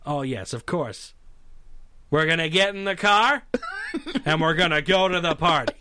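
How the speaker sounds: put-on supposed Indian accent that stays pure cowboy star; halting movie-hero impression, accent unchanged; John Wayne. Star failing a requested Indian accent, John Wayne impression. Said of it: "give it to us in an Indian accent".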